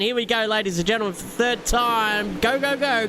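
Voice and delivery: rising, building to the green; excited countdown. Acted. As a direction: call the restart with hype. Restart called out.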